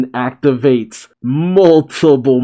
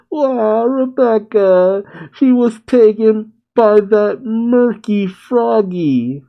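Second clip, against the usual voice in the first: high, slow cartoon wail; hammed-up crying character voice; 9 semitones higher, slower than usual. A narrator's reading. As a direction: bawl it out in a drawn-out high whine. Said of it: "just simply cries".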